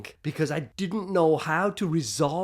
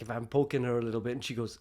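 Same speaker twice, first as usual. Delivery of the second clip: ordinary delivery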